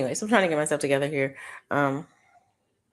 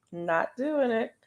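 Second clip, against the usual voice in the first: slow, higher-pitched mock refusal; self-talk refusal; 6 semitones higher, slower than usual. Shopper repeating it to herself in the store, slow playful refusal. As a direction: draw out a playful no to yourself. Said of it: "Kept saying to myself".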